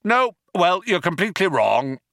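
loud, high, quick contradiction; angry Vogon. A narrator's reading. Vogon dismissing his flatterers, angry. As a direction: cut them down hard.

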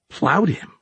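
high indignant squeak. A narrator's reading. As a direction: squeak it as an outraged question. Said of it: "she squeaked".

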